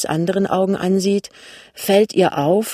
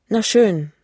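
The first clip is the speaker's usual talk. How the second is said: mildly resigned agreement, pitched up; 5 semitones higher, 4 dB louder than usual. A narrator's reading. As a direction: give in with a small falling close. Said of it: "sagt Lämmchen ergeben". (leicht ergeben)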